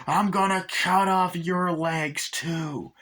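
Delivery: high, slow sneering doll voice; threatening Chucky. Retold or imitated. Chucky threatens to cut Andy's legs, slow sneer. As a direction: savor each threat. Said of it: "and he's like".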